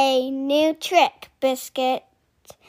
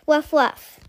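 whisper. Acted. Dog barks in picture book.

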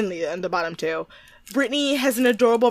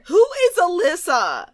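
mock bafflement; 8 semitones higher than usual. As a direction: demand it as if utterly baffled.

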